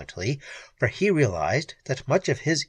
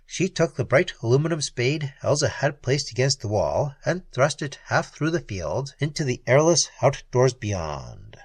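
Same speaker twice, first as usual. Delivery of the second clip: ordinary delivery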